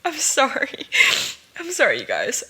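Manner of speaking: real tears turning into a laugh; teary laughing apology. Real, not acted. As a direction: apologize while laughing and crying at once. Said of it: "I'm crying".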